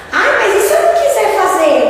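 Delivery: high, loud scoffing question; mock the lazy refusal; mocking whine. Speaker mocks the careless version of Kardec's question, scoffing. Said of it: "A pergunta debochada".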